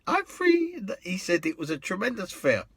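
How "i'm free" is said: very high, lilting I'm free; camp catchphrase. Retold or imitated. John Inman answering the cabbie, high and camp. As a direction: toss it off playfully camp. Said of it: "He went".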